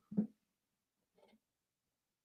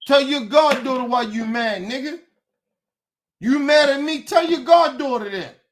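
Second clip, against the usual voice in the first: real heated demand; angry shout; 7 semitones higher than usual. Real, not acted. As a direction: throw the challenge at him. Angry rant at friend.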